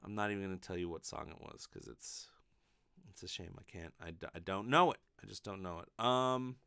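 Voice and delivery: usual speaking voice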